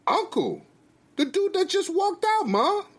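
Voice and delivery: high-pitched, insistent explanation; impatient son, high voice; exasperated son. Acted. Son explains to baffled mother.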